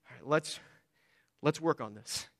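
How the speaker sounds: patient parent, low and soft; long-suffering patience. Acted. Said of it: "long-sufferingly and patiently say".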